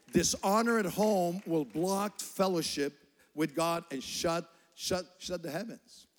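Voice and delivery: loud, slow warning from the pulpit; forceful preaching. Acted. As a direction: drive the warning home.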